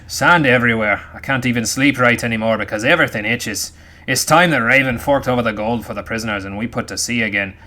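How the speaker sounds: loud gruff pirate captain. Shown as a demonstration. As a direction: growl it like a salty sea captain. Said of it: "Let me see if I can get my pirate voice on".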